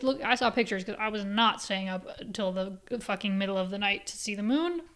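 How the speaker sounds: ordinary delivery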